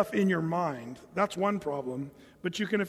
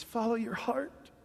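choked-up sentiment; 3 semitones higher, 5 dB quieter than usual. Shown as a demonstration. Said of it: "You gotta say it like that".

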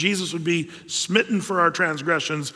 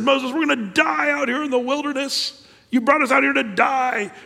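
complaining crowd; 7 semitones higher than usual. Acted. Israelites complaining to Moses.

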